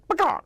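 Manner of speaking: high clucking bok; chicken squawk. Shown as a demonstration. Actor demoing the rooster's squawk, high chicken cluck. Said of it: "he's gonna sound like chickens".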